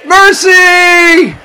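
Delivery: very high, loud cry for help; desperate cry. Shown as a demonstration. Soul calling to the Lord, desperate shout. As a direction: scream out as if drowning. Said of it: "That's what it means when you're chanting".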